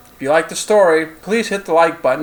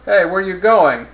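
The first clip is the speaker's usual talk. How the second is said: higher call after someone leaving; raised-pitch shout-after, lightly acted; 5 semitones higher, 6 dB louder than usual. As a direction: call out to stop them walking off. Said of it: "the handler called after her".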